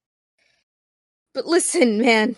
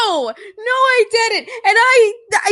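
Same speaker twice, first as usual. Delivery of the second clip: laughing; 9 semitones higher, 4 dB louder than usual. Real, not acted. Laughing denial at the table.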